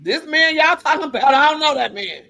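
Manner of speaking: defiant eulogy. Retold or imitated. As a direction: announce it to the whole room, defiant.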